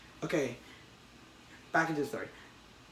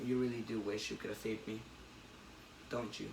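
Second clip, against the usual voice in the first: lower, softer sneering taunt; taunting Goblin; 6 semitones lower, 12 dB quieter than usual. Retold or imitated. Retelling the comic, the Goblin taunts Peter softly. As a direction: purr it with quiet menace.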